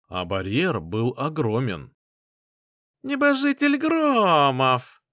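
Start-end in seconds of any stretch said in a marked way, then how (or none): 3.0-5.0 high, slow, squeaky sneer; shrill-toned drawn-out taunt; squeaky unpleasant voice. Acted. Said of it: "неприятным песклявым голосом".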